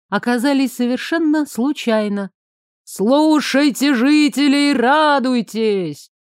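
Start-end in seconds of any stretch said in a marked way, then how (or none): 2.8-6.1 town crier. A narrator's reading. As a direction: call it out across the square like a herald. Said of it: "Усиленный магией голос глашатая".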